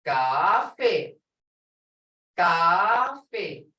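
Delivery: "Coffee" with a long held "ah" in the first syllable; dragged-out vowel. Shown as a demonstration. Teacher asking students to hear the sound, long vowel. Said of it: "It's dragged out".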